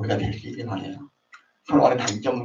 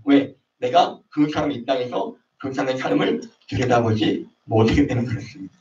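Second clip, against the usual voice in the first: normal talking voice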